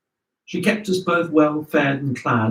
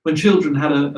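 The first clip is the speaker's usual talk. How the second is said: ordinary delivery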